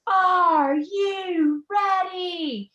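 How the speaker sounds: high, drawn-out rallying call; cheer-starting yell. Acted. Student calling the campus to chant, rallying yell. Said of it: "you hear somebody yell".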